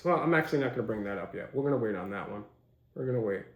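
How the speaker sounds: low, soft aside; backing off. Real, not acted. Host deciding to hold a topic back, quietly.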